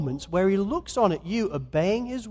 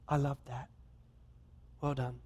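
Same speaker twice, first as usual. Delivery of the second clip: tender praise; 6 semitones lower, 12 dB quieter than usual. Retold or imitated. God tenderly commending hidden deeds.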